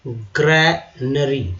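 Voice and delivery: ग्रैनरी stretched out slowly, syllable by syllable; slow model word. Shown as a demonstration. Teacher answering his own question, slowly spelling the word. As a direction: lay out ग्रैनरी slowly for the class. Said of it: "अब खलिहान का क्या बोलेंगे?".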